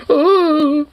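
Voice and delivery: high, loud fearful "Oh"; mock scared gasp. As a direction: squeal like a scared aristocrat. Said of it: "he's all, like".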